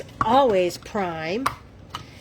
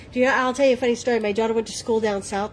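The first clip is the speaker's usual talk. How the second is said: normal talking voice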